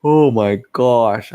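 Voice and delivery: slow, stretched through a real yawn; sleepy yawning exclamation. Real, not acted. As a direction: yawn the words out. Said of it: "That's probably why I'm doing so much yawning".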